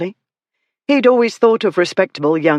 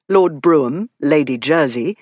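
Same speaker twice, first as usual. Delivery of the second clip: plain reading voice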